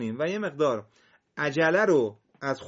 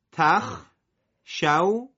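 اتخشونهم read slowly and louder, split into parts; syllable by syllable; 3 semitones higher, 4 dB louder, much slower than usual. Shown as a demonstration. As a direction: model اتخشونهم in separate syllables. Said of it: "اگه تفکیک بخونی".

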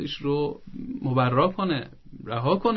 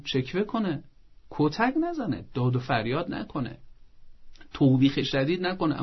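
ordinary delivery

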